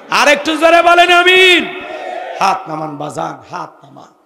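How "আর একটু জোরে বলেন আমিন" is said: very high, very loud cry; soaring, forceful rallying call; full-voiced call for louder Amen. Real, not acted. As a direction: shout to pull a bigger Amen from the crowd.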